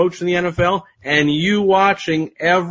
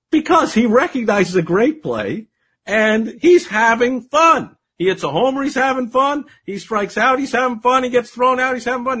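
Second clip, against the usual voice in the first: enthusiastic reply; 8 semitones higher than usual. Retold or imitated. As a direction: cheerfully hammer home that he's having fun. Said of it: "I'm like".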